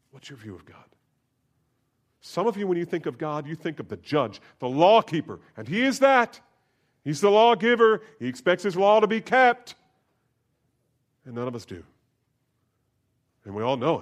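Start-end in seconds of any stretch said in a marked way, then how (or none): 0.1-0.8 softer, hushed repeat of What's your view of God. Shown as a demonstration. Pastor repeating the question to the congregation, hushed. 2.2-9.8 loud, high preacher's proclamation; full force preaching. Acted. Preaching God as judge.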